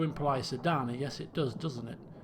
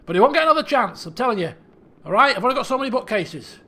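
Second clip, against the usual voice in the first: loud, exasperated father; stern king; 5 semitones higher, 10 dB louder than usual. Acted. King warning his daughter about the bear, stern. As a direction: lay down a loud final warning.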